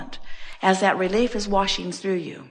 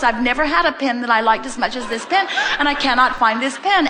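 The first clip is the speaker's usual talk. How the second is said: rising, louder, higher fretting about the lost pen; hammed-up frantic worry, climbing higher; frantic pen search; 4 semitones higher, 3 dB louder than usual. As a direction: whine it like a panicked owner.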